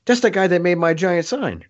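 as if it just clicked who he is; mock excited realization; imitated excitement.

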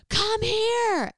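high, loud, sharp order; bossing his sister back; imitating an angry brother; angry command. Angry brother voiced in story.

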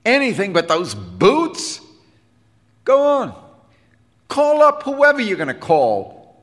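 high, loud angry challenge; defiant retort. A narrator's reading. Defiant boy daring the bandleader to call, high and loud. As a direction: shout it back at him, daring him.

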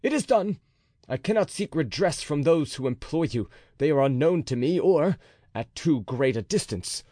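captain swearing to kill the officer; furious oath. A narrator's reading. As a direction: swear it with mounting rage. D'Artagnan's sworn threat.

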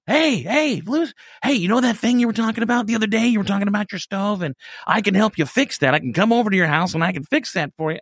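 high, bright, pushy eager fan voice; eager stalker voice. Retold or imitated. Stalker offering help, high and eager. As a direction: play the overeager stalker, pitched high.

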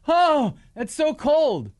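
whiny. Retold or imitated. Mocking celebrities' reaction.

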